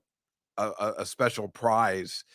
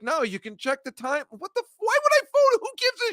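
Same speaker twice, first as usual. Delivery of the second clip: much higher, indignant mock protest; defensive retort. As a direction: insist it in a squeaky defensive voice. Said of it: "And he's like".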